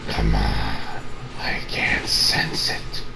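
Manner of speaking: very low, whispered strain. Retold or imitated. Goku straining to sense energy, whispered low. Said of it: "He's like".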